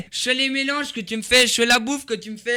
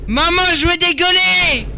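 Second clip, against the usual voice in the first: cri dégoûté; 9 semitones higher, 8 dB louder than usual. Real, not acted. Dégoût crié à sa mère.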